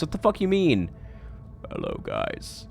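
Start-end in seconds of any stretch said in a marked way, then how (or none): 1.7-2.7 put-on much lower, much softer, a tired old smoker's mumble; depressed old pirate guy. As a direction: drop into a very deep, weary old man's voice. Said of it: "He sounds like he's seventy-eight and depressed".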